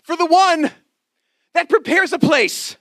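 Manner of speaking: high, loud preaching with a repeated refrain; preaching at full force. Acted. Preacher proclaiming the refrain to the congregation, high and loud.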